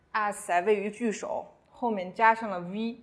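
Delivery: ordinary talk, nothing marked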